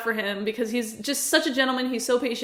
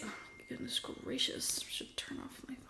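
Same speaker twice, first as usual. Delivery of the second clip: whispered aside; 18 dB quieter than usual. Real, not acted. Aside to herself mid-review.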